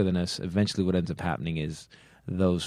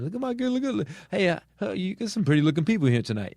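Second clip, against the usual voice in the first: much higher mock stand-up voice; mock comedian patter; 12 semitones higher than usual. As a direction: babble like a hacky comic greeting the crowd. Mimicking a hacky stand-up comic.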